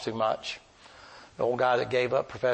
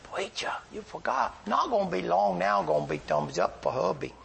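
Herbie's voice; 7 semitones higher than usual. Retold or imitated. Herbie voiced reminding the preacher.

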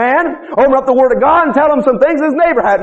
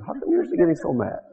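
much softer and lower, mock-gentle question; charitable; 8 semitones lower, 11 dB quieter than usual. Preacher re-enacting his question to an angry man, charitably. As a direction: ask it hushed, with exaggerated charity. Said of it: "We said that with a lot of charity".

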